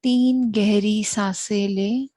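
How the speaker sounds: very slow, drawn-out meditation guidance, long pauses between phrases; guided meditation. Real, not acted. Host guiding listeners to watch their breath, slow meditative voice. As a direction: lead them into stillness, unhurried and even.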